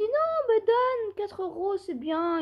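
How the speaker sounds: high, slow voice for the seller; lightly voiced merchant; mock seller. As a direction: put on a high, drawn-out voice for him. Seller's reply voiced in retelling.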